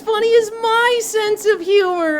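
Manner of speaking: put-on obnoxious e-boy yell. E-boy butting in between the girls, loud obnoxious yelling. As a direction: bellow it like a clueless try-hard. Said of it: "and goes".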